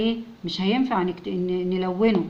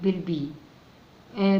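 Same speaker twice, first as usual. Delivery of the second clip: ordinary talk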